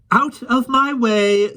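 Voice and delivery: Mary Poppins brushing the keeper off; brisk Mary Poppins. A narrator's reading. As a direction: sweep him aside.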